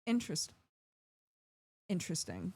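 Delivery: word cut by a hiccup. Real, not acted. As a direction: hiccup mid-word, then repeat. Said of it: "Sorry, I hiccuped at the same time".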